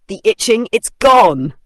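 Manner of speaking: loud, joyful burst of relief; a hammed-up happy cry; relieved lion. A narrator's reading. Lion celebrates the fleas gone, loud relief.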